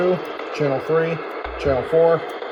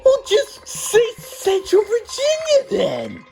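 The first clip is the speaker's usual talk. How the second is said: exasperated; slower than usual. Real, not acted. Exasperated reply.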